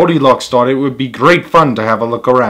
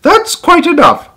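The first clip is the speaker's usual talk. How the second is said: much higher, louder stern rebuke; Mama Bear stern; 5 dB louder than usual. Acted. Mama Bear scolding Baby Bear, high and stern. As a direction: cut the fuss short like a mother.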